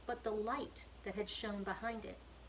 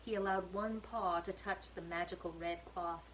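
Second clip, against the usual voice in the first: plain reading voice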